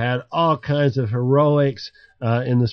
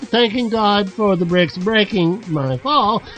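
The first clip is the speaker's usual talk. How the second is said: a light Irish-Australian lilt, told much higher at the peaks; Irish Australian accent; 4 semitones higher than usual. Acted. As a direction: tell the mishap story in a hint of an Irish-Australian accent. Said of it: "You were channeling the Irish the whole time".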